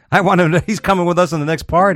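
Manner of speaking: high-pitched, eager party-guy enthusiasm; mock excited partygoer. Imagined partygoer inviting the playboy along, mock excited. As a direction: play an admiring guy lobbying for him. Acting out guys admiring the playboy.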